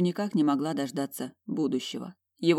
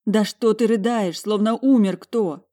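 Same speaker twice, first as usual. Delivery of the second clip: louder, higher, upset questioning; agitated; 4 semitones higher, 7 dB louder than usual. A narrator's reading. Asking her sobbing sister who died, agitated. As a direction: let her distress infect the voice. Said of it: "Её состояние передалось и мне".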